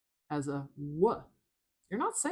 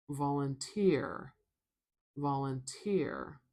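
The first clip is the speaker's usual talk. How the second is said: slow "volunteer" with weight on TEER; stress on final syllable; slower than usual. Shown as a demonstration. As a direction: land on the end of "volunteer", drawn out. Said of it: "the stress is at the end".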